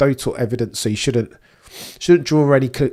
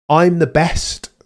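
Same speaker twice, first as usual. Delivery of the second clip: slow, louder self-belief mantra; Rose Namajunas mantra; 3 semitones higher, 4 dB louder, slower than usual. Retold or imitated. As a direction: say it slowly like a fighter psyching herself up. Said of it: "Rose Namajunas settings".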